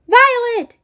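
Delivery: very high, loud scream of the name; a mother calling in terror; piercing frightened cry. A narrator's reading. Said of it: "screamed Missus Beauregard".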